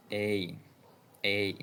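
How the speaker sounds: "A" drawn out, gliding from e to i; slow, clear teaching model of "A"; model diphthong. Shown as a demonstration. Teacher modelling the diphthong "A" for learners. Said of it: "you get the diphthong".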